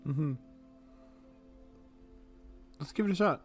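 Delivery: ordinary delivery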